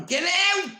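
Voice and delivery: put-on very high, loud shout of an old woman; granny shouting. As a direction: yell him out of the house. Granny throws him out.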